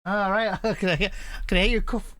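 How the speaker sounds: ordinary talk, nothing marked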